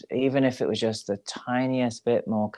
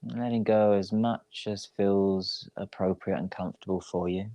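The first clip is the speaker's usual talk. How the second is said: guided meditation. Real, not acted. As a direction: ease them into rest, unhurried and even. Leads a yoga nidra relaxation.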